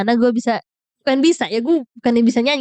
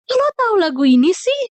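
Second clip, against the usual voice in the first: surprised; 9 semitones higher than usual. Retold or imitated. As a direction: gasp it out in disbelief. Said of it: "gue kaget kan kayak". (kaget banget, suara melengking)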